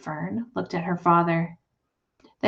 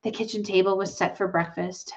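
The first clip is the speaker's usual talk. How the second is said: ordinary delivery, nothing marked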